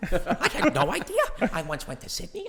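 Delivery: very high, loud, excited gushing; hammed-up astonished squeal with laughter; Edie Falco. Co-star discovers he is Australian, loud high imitation. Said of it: "so much fun and really loud".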